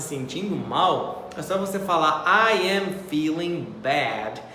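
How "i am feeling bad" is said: slow, careful classroom example. Shown as a demonstration. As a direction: spell it out slowly for learners. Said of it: "example sentence".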